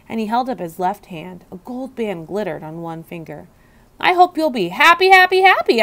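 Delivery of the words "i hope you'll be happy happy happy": higher, louder, building delight; delighted squeak. A narrator's reading. Hamster congratulating the newlywed custodian, delighted squeak. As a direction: gush it with joy. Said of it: "I squeaked with delight".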